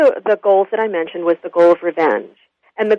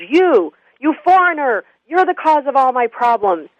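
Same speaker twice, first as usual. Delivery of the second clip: retold drunk lashing out, pitched up; drunken angry accusation; 6 semitones higher than usual. Drunk laborer confronts the foreigner on a train, angry mock accusation. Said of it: "he starts to swear and yell".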